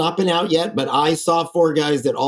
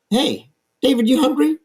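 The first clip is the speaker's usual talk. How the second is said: cheerful call; 10 semitones higher than usual. Retold or imitated. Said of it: "he says".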